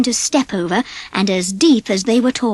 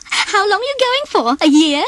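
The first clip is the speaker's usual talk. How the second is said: high, teasing, giggly question; giggling; 10 semitones higher than usual. Acted. Minnie teases the campers about their overloaded car, giggling. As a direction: tease them through a giggle. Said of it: "she giggled".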